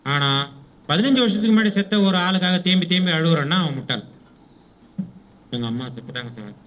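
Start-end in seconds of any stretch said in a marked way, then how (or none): 5.6-6.6 low, subdued grief, much softer; mock grieving. Mourner telling sir that mother has died, mock grieving. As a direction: drop to a small, sorrowful voice, acting the mourner.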